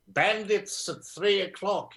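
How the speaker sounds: imitating dad as a fighter pilot; Spitfire pilot. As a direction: ham up the wartime pilot. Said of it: "used to sound like a Spitfire pilot".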